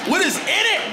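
bursting with disbelief; incredulous outburst. Real, not acted. Comic disbelief at the food.

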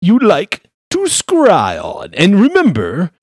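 a pompous high-voiced lawyer flattering a client; pompous gentleman. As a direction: gush like a fussy wizard lawyer in a top hat. Lawyer character continues.

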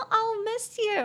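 high, drawn-out sappy goodbye; put-on, high-pitched sentimental farewell; mock-sappy farewell. Telling a friend leaving for America, mock-sappy.

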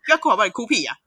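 high, loud imitated scolding; mock-impatient younger sister telling her to quit crying. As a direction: snap at her to stop crying.

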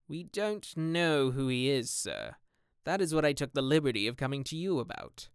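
gamekeeper voice. Acted. Gamekeeper answers his master.

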